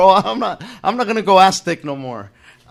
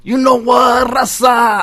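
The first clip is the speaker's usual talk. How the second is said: high, raised catchphrase shout; mock scream; 8 semitones higher, 3 dB louder, slower than usual. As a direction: holler it like a teasing comic. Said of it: "raza was them motherfuckers screaming that".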